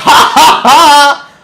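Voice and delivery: high loud taunting ha ha ha; gloating mock laugh. Brother gloating over the pot with a loud mock laugh. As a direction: cackle at the sucker you just beat.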